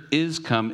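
"is" lifted high and leaned on; stress on is. Shown as a demonstration. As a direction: hit the word "is" to show presence. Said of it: "emphasizes the state of being here".

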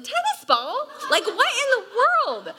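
yelping in disbelief at tennis-ball hail; shocked disbelief. Retold or imitated. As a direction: shriek it in amazed horror.

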